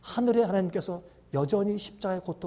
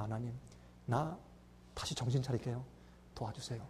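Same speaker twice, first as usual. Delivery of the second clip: soft contrite prayer; 5 semitones lower, 13 dB quieter than usual. Acted. Quoted humble prayer of repentance.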